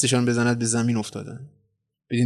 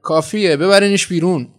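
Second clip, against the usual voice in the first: louder, higher, sharp order; commanding; 6 semitones higher, 6 dB louder than usual. A narrator's reading. Supervisor ordering Jilda taken out, commanding. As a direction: order them out with raised voice. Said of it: "امر کرد".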